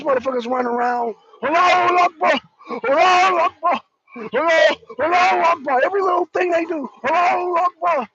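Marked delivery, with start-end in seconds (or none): 1.4-5.8 higher, louder mock chant; mock yelling. Mocking fighters giving away their position, mock yelling. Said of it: "They're yelling". 7.0-8.1 mock yell. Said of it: "They're yelling".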